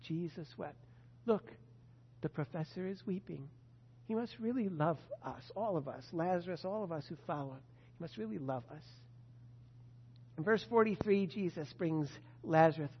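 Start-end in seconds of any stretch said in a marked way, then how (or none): 0.0-8.8 soft, moved murmur; hushed awe. Acted. Preacher voicing followers watching their teacher weep, hushed and moved. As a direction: voice the onlookers' quiet wonder. Imagines onlookers seeing Jesus weep.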